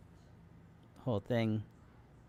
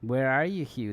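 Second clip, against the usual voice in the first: calling out; 2 semitones higher, 7 dB louder than usual. Acted. Calling out for the ghost.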